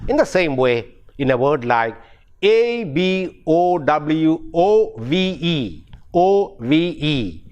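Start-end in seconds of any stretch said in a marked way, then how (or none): none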